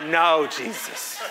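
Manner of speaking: slow, drawn-out dismayed plea with a laugh; dread. Retold or imitated. Dad spotting the field-trip moms, slow dismayed plea. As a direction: beg heaven to spare you.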